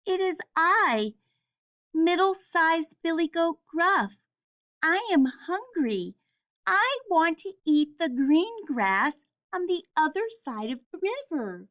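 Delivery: high goat voice; middle-sized goat voice. Acted. Middle goat answers the troll in a high goat voice. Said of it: "in his middle-sized goat voice".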